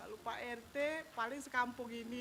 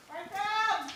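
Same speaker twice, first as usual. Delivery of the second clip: loud high call; 7 semitones higher, 11 dB louder than usual. Acted. Calling out at door.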